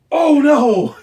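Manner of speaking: high, loud startled cry; alarmed oh no. Retold or imitated. Father Christmas learns his mic was live, loud alarmed cry. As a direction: blurt it in sudden horror.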